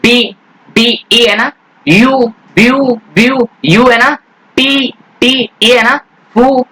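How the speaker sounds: teacher sounding out word parts slowly; syllable by syllable. Shown as a demonstration. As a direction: spell the vowel sounds out for children. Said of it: "जैसे".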